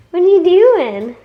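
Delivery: loud, high-pitched question with a falling end. Real, not acted. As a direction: call out high and loud to a little one. Calling playfully to her young child.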